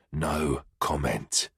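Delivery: low, slow, quiet growl; deep growl. Acted. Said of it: "in what sounded like a deep growl".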